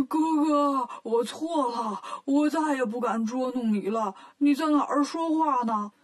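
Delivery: scared pig begging his big brother; lightly voiced frightened Bajie; pleading Bajie. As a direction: beg for mercy, flustered.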